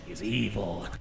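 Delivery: low hammed-up funny voice. Narrator revealing the truth about Malice, funny voice. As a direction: drop into a lightly comic low voice. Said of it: "he also did a funny voice".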